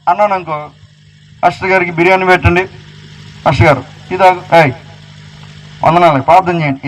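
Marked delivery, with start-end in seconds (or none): 1.4-6.9 lower, quick, fawning mimicry; fawning church member. Preacher mimics members pampering the pastor, quick and fawning. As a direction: rattle off flattery like a toady.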